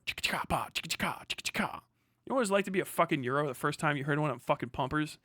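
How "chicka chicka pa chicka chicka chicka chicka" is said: rapid percussive mouth noises of a gun; imitated shotgun pumping. Showing Europeans the pumpers, imitated gun clicks. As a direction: make pump-action clicks with the mouth.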